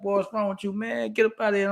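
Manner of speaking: lightly voiced irritated grown-up; annoyed adults.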